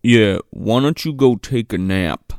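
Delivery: low-pitched mock suggestion; imitated manipulative boyfriend. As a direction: pitch it down like the boyfriend dismissing her. Boyfriend sends her off to nap.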